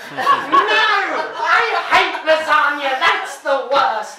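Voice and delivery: loud, high, slow outraged cry through laughter; outraged shout. Acted. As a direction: wail it like a boy who hates lasagna. Boy outraged at his lasagna label.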